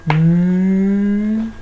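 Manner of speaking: "N" as a long, loud hummed nnnn; "N" held out, not named as a letter; sound of N. Shown as a demonstration. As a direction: sustain "N" as a pure nasal sound. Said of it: "la lettre qui fait le son".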